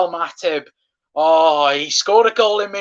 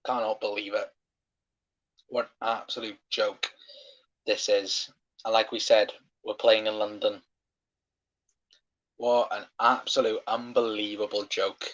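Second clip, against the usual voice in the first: low, much softer, muttered disbelief; deflated disbelief; 6 semitones lower, 8 dB quieter than usual. Real, not acted. Fan deflated after the goal is ruled out, soft low disbelief. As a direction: sink into quiet disbelief.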